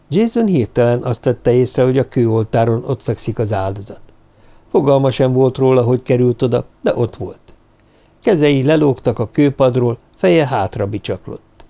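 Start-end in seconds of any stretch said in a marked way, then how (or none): none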